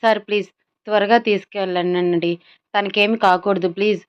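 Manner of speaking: ordinary delivery, nothing marked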